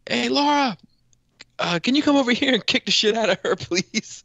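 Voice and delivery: high, loud call across a bar; yelling across the bar. Retold or imitated. Man yelling across the bar to his wife, loud high call. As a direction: shout it over the crowd to his wife. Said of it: "I yelled across the bar".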